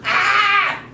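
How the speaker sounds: high, loud pretend shriek; short hammed-up girlish scream. Little girl finds bear in her bed, mock scream. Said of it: "She screamed".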